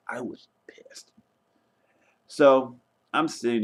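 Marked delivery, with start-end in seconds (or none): 0.1-1.1 unvoiced whisper, slow, for the family's sake; whispered aside. Real, not acted. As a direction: breathe it out like a secret so nobody in the house hears. Whispers after mentioning yelling indoors.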